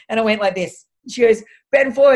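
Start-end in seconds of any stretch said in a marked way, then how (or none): 1.7-2.2 loud, higher barked order to bend; retold command. Physio ordering her patient to bend, loud command. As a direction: order him down like a strict physio.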